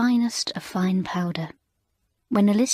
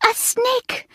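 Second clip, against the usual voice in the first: shrieked much higher; screaming in fright. A narrator's reading. French mistress hearing of a snake, frightened shriek. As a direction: leap up and shriek the word. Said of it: "sprang up with a scream".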